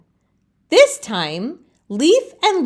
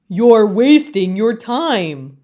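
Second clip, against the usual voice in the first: slow, louder chorus of leaves; know-it-all leaves; 5 dB louder than usual. Acted. Other leaves scold Leaf, slow and loud. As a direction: lecture it slowly like smug elders. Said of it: "the other leaves say".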